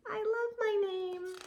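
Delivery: put-on high, soft little girl delighting in her name; a small girl hugging her own name; happy child voice. A narrator's reading. Child character loves her name.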